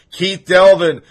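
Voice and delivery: ordinary delivery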